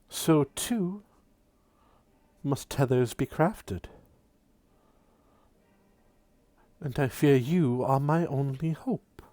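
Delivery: a hushed otherworldly woman asking for help; mysterious woman's plea. Acted. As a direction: implore the chosen one quietly. GM voices the woman.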